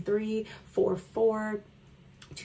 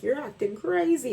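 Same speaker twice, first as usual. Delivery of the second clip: gaslighting friend; 6 semitones higher than usual. Retold or imitated. Imitating a gaslighting friend, high mock accusation. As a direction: throw the accusation back mockingly.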